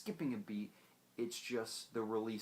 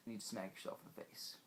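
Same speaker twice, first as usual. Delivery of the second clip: muttered aside; 2 semitones lower, 9 dB quieter, faster than usual. Real, not acted. Snarky aside to viewers.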